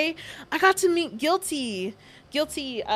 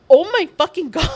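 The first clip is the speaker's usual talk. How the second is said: loud, fast exclamation breaking into laughter; overjoyed outburst cracking up; giddy; 3 semitones higher, 9 dB louder, faster than usual. Retold or imitated. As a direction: re-enact starstruck shock, laughing. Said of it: "again, I was so giddy".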